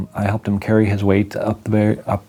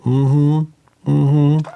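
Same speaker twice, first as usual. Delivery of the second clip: counselor's mm-hmm; 7 semitones higher than usual. Retold or imitated. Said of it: "and they'd go".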